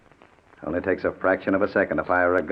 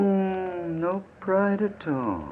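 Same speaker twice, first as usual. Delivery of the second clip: much higher, slow scorn; contemptuous sneer; 14 semitones higher, much slower than usual. Acted. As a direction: look down on him. Kidnapper scorns begging victim.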